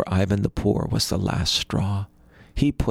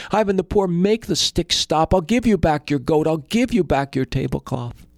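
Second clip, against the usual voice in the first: much higher, louder frantic plea; desperate begging; 8 semitones higher, 4 dB louder than usual. Acted. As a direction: beg for the beating to stop. Beaten rich brother begs.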